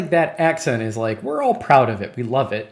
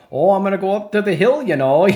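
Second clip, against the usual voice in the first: put-on spoof a cowboy with a Wisconsin accent, pitched up and rushed; mock Wisconsin cowboy; 7 semitones higher, 6 dB louder than usual. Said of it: "no cool cowboy was like".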